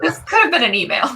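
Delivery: high, giggling through the punchline; repeating the joke through laughter; laughing. Real, not acted.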